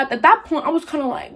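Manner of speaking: usual speaking voice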